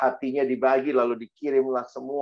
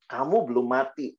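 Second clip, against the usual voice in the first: ordinary delivery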